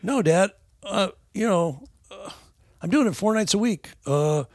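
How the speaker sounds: high, earnest son voice; imitating son. Father voicing his son's earnest reply, high and eager. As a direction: play the eager son defending himself. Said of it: "It's like".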